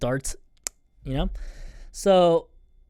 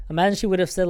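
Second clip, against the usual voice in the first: usual speaking voice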